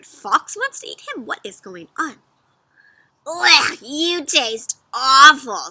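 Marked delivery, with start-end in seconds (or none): 3.3-5.7 louder, slow disgust; disgusted fox. Acted. Fox spitting out the bad cookie. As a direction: spit it out in disgust. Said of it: "said Fox".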